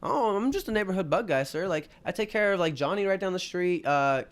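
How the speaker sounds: high, sugary innocent voice; fake-polite bug guy. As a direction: play dumb for the angry man. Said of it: "I'm like".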